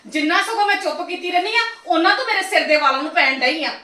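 ordinary talk

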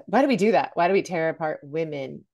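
normal talking voice

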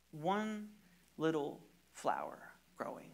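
hushed, whispered image; a secretive whisper in a sermon. Acted. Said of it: "hushed sermon image".

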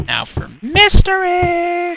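high, slow, loud proclamation; dramatic announcement. Real, not acted. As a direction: announce it like a showman.

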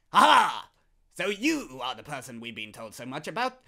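hammed-up mysterious guild boss; villain voice. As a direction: read it like a menacing secret society boss. Voicing game character dialogue.

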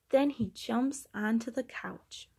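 usual speaking voice

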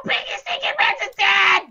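high, loud gleeful yell; excited shout. Real, not acted. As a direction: shout the kill in glee. Gamer shouts kill.

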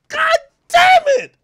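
high, loud anguished yell; frustrated shout. Retold or imitated. Reacting to the ruined porn scene, with a high loud wail. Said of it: "I'm like".

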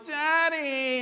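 put-on high, drawn-out kid's whine; whiny child. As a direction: imitate a child whining for a parent. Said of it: "My kids will talk in whinese".

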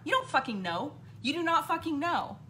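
normal talking voice

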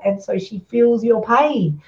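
ordinary talk

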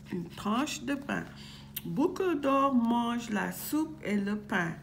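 usual speaking voice

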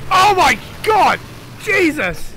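slow, high, loud exclamation; alarmed shout. Real, not acted. Player nearly killed in the game, shocked shouting. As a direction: cry out in shock, drawing the words out. Player takes a near-fatal hit.

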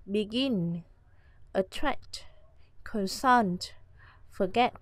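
begin, attract, consult, forget, each stressed on the last syllable; stress on second syllable. Shown as a demonstration. Teacher lists verb examples begin, attract, consult, forget, second-syllable stress. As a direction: hit GIN, TRACT, SULT, GET in begin, attract, consult, forget. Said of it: "trọng âm sẽ rơi vào âm tiết thứ hai".

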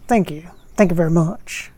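[name] impression. Medium asked by [name] to imitate him, light [name] voice. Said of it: "Are you gonna do an impression?".

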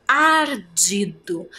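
ardido stretched by syllable; slow syllables. Shown as a demonstration. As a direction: count it out slowly. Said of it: "Ardido tem quantas sílabas". (devagar, sílaba por sílaba)

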